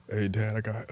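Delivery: soft, hesitant mock-nervous start; nervous shaky retelling. Son starting a hard confession to dad, re-enacted nervously. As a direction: play it timid and faltering, barely out. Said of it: "Voice all shaking".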